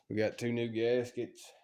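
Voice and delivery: ordinary talk, nothing marked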